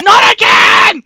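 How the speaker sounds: slow, high loud yell of pain; mock scream. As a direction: scream it as if stung again. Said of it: "he's like".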